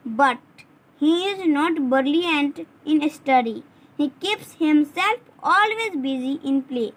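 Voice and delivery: slow, word by word for dictation; slow model paragraph read. Shown as a demonstration. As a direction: dictate slowly so they can copy it. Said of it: "इसे आप लोग सकते हो".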